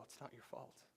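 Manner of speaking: quiet, tender voicing of God; soft reassurance. God tells the hurting listener softly, quiet comfort. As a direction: say it gently, like consoling a child.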